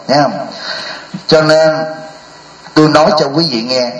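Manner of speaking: ordinary delivery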